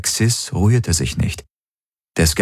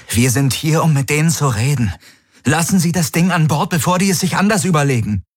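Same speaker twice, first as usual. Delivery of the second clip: higher and fast, pressing; urgent plea; 9 semitones higher, faster than usual. Acted. Galen pleading with Daraios to let the probe in, urgent. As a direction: rush to convince him.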